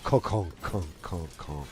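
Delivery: sputtering conks that drop and fade; imitated engine conking. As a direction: mimic a car dying out. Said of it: "the car goes".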